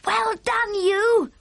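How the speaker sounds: put-on high, bright little girl's cry of praise; excited cry. A narrator's reading. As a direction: call it out high like a delighted child. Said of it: "Sophie cried".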